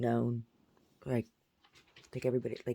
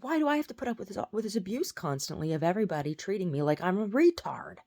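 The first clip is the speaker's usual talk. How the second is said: high, louder retold outburst; indignant protest; 6 semitones higher, 5 dB louder than usual. Daughter answers dad's yelling in a dream, indignant retold protest. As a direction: protest the mistreatment, pitched up and exasperated.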